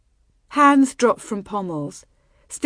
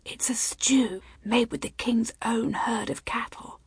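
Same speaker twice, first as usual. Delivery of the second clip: higher, breath-only confidential whisper; 4 semitones higher, 6 dB quieter than usual. A narrator's reading. Bride explaining the dish to her groom, whispering. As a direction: whisper it close into his ear. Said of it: "She leaned close to whisper in his ear".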